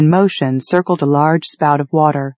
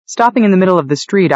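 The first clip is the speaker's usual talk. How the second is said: ordinary delivery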